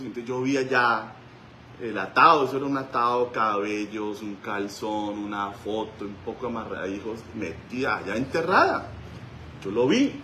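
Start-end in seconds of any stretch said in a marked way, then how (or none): none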